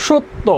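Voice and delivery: সত্য as shotto with doubled t and final o; final o sounded. Shown as a demonstration. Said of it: "সত্য, উচ্চারণ কি হবে?". (সত্য শব্দের উচ্চারণ শট্টো)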